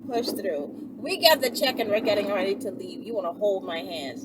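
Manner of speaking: usual speaking voice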